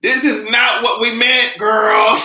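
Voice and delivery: high, loud, slow protest; high outraged slow protest; exasperated shout. Retold or imitated. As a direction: cry it out in disbelief. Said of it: "I was like".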